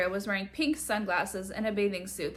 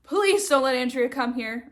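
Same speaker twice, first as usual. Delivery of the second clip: plain reading voice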